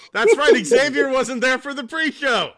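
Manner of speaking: loud, high teasing call; loud callout. Real, not acted. Loud teasing callout of a cohost who missed the show. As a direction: call him out loudly.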